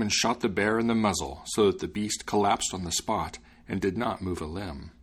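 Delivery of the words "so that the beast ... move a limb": ordinary delivery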